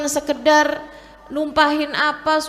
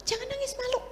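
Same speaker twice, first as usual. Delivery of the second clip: imitated parent, high and soft; parental shushing; 11 semitones higher, 8 dB quieter, faster than usual. Parent telling a daughter not to cry, shushing. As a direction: hush a crying child in a high, soft mock-parent voice.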